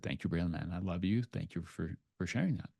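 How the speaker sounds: soft, grateful words to his son; tender thanks. Retold or imitated. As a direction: hush it down and thank him tenderly.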